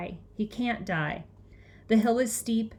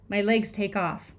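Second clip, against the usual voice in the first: ordinary delivery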